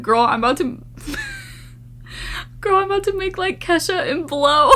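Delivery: high voice cracking into laughter; half cry, half laugh through the punchline; laughing voiced butt. Her butthole voiced telling her, laughing hard.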